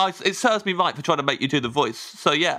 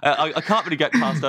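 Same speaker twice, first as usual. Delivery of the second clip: ordinary talk, nothing marked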